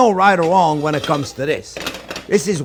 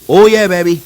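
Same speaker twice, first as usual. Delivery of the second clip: excited; 8 dB louder than usual. Real, not acted. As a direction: shout it with glee. Excited at the sizzling meat.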